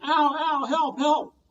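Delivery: high, loud yelp for help; pained cry. Acted. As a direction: cry out as if pecked. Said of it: "The ogre guard went".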